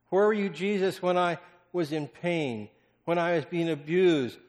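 loud, high and pleading; anguished outcry. Acted. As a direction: cry out the hurt to Jesus. Climax of lament to Jesus.

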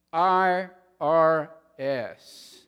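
I R S spelled out slowly and loudly, each letter a threat; ominous IRS. Acted. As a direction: drop it like a doom sentence.

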